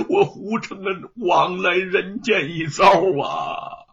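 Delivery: sobbing shout turning to bitter laughter, slow and loud; wailing and laughing at his own failure; laughing through tears. Acted. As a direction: cry out in despair, then break into a broken laugh. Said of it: "就在泪水中大笑起来".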